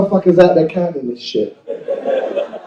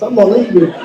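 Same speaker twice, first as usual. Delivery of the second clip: ordinary delivery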